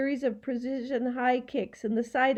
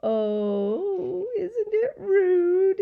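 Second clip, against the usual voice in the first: high, drawn-out mocking squeal; delighted scream; 9 semitones higher, 5 dB louder, much slower than usual. A narrator's reading. Gaboon delighted at the boy's rudeness, screaming. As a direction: scream it, climbing high and loud. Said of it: "he screamed".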